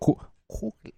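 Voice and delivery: high, hushed, broken attempt; tentative squeaky put-on voice; failed character voice. Shown as a demonstration. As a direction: try a high character voice and falter. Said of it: "I can't do it in that voice".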